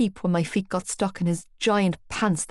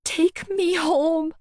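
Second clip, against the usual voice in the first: high, slow pleading; begging; 9 semitones higher, much slower than usual. Acted. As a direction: beg to leave, terrified. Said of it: "I begged".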